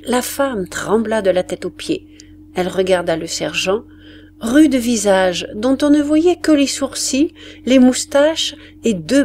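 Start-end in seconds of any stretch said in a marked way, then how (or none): none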